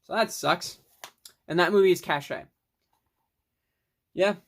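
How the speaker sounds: ordinary delivery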